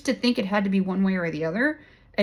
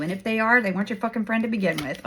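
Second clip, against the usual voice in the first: ordinary talk, nothing marked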